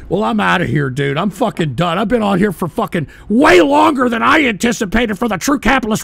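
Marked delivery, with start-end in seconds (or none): none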